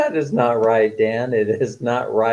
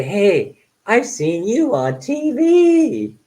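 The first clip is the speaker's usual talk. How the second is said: starstruck fan; 10 semitones higher, 3 dB louder, much slower than usual. Pretend. Imagines greeting a celebrity.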